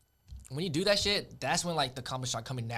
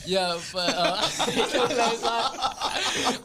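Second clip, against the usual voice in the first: laughing; 9 semitones higher, 8 dB louder, much slower than usual. Real, not acted. Laughing after bad accent.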